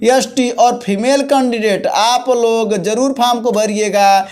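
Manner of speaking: ordinary talk, nothing marked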